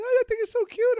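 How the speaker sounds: put-on high cooing girl voice. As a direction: coo it high like a schoolgirl. School girls voiced cooing.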